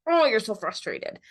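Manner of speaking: emphatic, matched-up "Oh, you're so frustrated"; intonation that mirrors the emotion; mirroring intonation. Shown as a demonstration. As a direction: give the words real feeling. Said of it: "a qualitative difference to".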